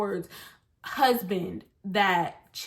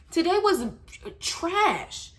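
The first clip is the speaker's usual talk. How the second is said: wailing complaint; 9 semitones higher, slower than usual. Real, not acted. Telling viewers her day was trash, high wail. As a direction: whine it up high. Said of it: "I cried".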